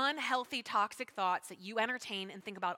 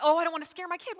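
mocking parent; 6 semitones higher, 7 dB louder, faster than usual.

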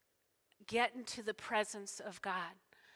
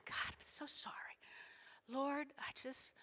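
hushed, higher, pleading whisper; tearful apology; 4 semitones higher, 7 dB quieter than usual. Retold or imitated. Woman in tears apologising to God, tearful whisper. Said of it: "just tears, going".

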